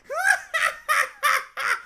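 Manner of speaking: high shrieking devilish cackle; evil cackle. Acted. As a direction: laugh like a triumphant imp. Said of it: "This fucking voice of evil".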